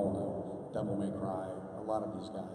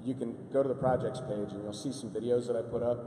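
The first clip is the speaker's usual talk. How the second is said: ordinary talk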